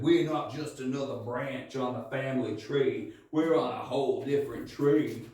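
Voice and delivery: lightly put-on Australian bloke declaring it; Australian accent quoting a song skit. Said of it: "in an Australian accent".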